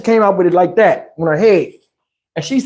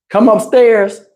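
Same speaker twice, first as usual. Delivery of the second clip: ordinary delivery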